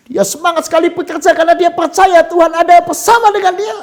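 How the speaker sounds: high, loud preaching at full force; soaring pulpit voice, proclaiming; fiery preaching. Acted. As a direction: preach it out with rising fire.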